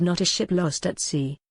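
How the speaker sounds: ordinary delivery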